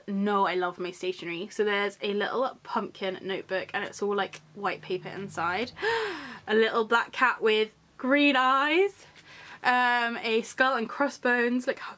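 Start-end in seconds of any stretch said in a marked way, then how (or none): 5.8-6.4 soft high gasp. Real, not acted. Finding rubbers.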